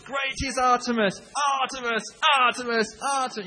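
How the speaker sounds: high, loud chanting shout of the name; crowd shouting Artemis. Retold or imitated. The rioting crowd hails their goddess, a high loud chanted shout. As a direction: bellow it like a rioting mob hailing their goddess. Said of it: "they just shouted".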